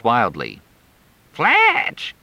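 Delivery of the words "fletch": very high, choked shout of his name; strangled cry. Acted. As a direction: cry out as if being shaken. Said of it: "sounded like she was strangling".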